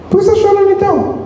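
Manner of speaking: high, fast, nosy scolding; mocking busybody. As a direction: needle him for crying, fast and high. Mocking spiritist in wake. (imitando um intrometido, agudo e rápido)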